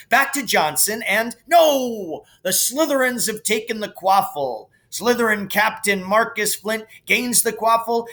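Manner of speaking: fast, high, excited play-by-play; match commentary. Acted. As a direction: rattle off the action like a schoolboy commentator. Lee Jordan commentating the match.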